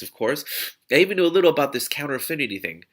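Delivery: usual speaking voice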